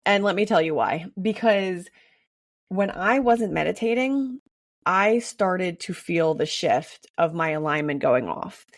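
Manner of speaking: normal talking voice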